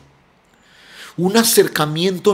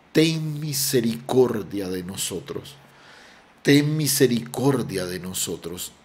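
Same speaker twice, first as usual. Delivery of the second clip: soft, lower-pitched plea, quoting the lepers; retold plea for mercy; 5 semitones lower, 6 dB quieter than usual. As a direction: plead quietly, letting the voice sink. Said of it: "y le suplican". (súplica citada, en voz baja y grave)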